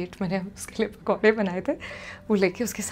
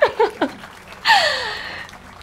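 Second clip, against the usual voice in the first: loud high laugh, falling away; high burst of laughter; 7 dB louder than usual. Real, not acted. Laughing at her story. (ज़ोर की ऊँची हँसी)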